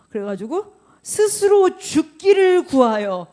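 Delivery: emphatic preaching. Acted. Preacher to congregation, loud slow declaration. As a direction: land hard on 스스로. Preacher reads Jonah's despair.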